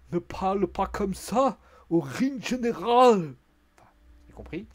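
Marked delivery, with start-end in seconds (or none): 0.1-3.3 loud, high mock German accent; Ludwig Kaiser. Kaiser scolding McIntyre, mock German accent. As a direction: bellow slowly in a thick put-on German accent. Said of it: "qui a fait l'accent de Ludwig Kaiser".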